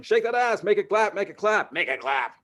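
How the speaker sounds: high, loud chanting crowd at a strip club; rowdy crowd. Retold or imitated. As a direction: hype the dancer.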